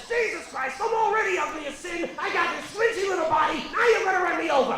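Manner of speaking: high, pushy, panicky little possum voice, racing by the end; whiny possum. Pretend. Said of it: "That look says".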